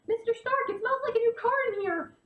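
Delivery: high-pitched eager kid impression; imitated excited Peter Parker. Quotes young Peter in film.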